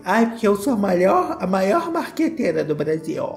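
mock a vain marketer; Boca Rosa impression. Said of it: "de repente eu ouvi a Boca Rosa". (imitando a Boca Rosa, voz afetada e aguda)

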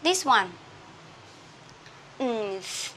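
ordinary delivery

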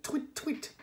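high, soft mocking birdsong; high whistled mock tweeting. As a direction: tweet it like a silly songbird. Raven mocks bird song.

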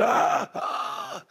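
high breathy scandalised gasp; a big theatrical gasp of horror; shocked gasp. A narrator's reading. Aunts and uncles aghast at the child, a long breathy gasp. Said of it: "His aunt and uncles were shocked".

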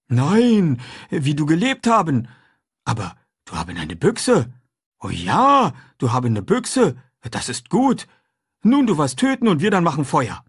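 a simple, eager man talking food; runaway man chatting with the boy; Jim's voice. A narrator's reading.

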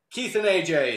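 ordinary delivery

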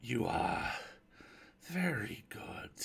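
slow, hushed exclamation; whispery, drawn-out thanks from the dark; grateful unseen stranger. Acted. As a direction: let the words trail out softly. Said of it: "exclaimed the voice".

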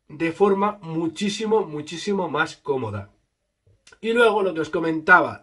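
muffled behind mesh mask; speaking with covered mouth; through a face mask. Real, not acted. Seen 3: Speaker's mouth covered by balaclava with mesh mask throughout; references show bare face.

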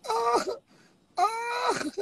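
high wailing oh through tears of laughter; real crying laugh; helpless laughing. Real, not acted. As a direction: laugh so hard it squeaks up high. Overcome laughing at the weed.

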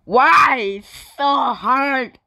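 high, drawn-out complaint; exasperated whine. Real, not acted. Player finding the game too hard, whining loudly. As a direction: wail it in frustration, loud then easing.

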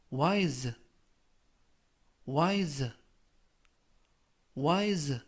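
careful dictionary-style word, spaced for repetition; slow model reading. Shown as a demonstration. Teacher reading review words for learners to repeat, slow and careful. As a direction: say each word slowly and distinctly, leaving room to echo. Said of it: "천천히 세 번씩 읽어드리고요".

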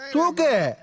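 high, commanding call; shouted order. Acted. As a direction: call out the order to your men. Ordering men to carry her off. (உரக்கக் கட்டளை)

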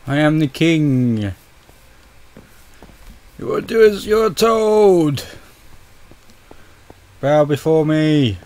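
slow commanding tyrant king voice; hammed-up evil ruler, drawn out slowly; dark lord. Player plays tyrant king in game.

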